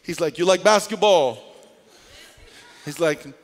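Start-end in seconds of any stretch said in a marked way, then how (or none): 0.4-1.4 mock Jamaican accent. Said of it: "he's got an accent".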